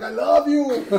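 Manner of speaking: high, loud, gushing I love you; hammed-up tequila-soaked adoration; gushing drunk fan. Crowd cheering the poet, in a gushing high voice. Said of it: "Everybody's like".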